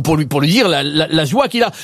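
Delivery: ordinary talk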